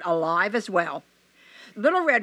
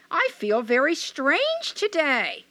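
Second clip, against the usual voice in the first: put-on high, slow, wondering young girl; uneasy little girl, drawn out; 6 semitones higher, slower than usual.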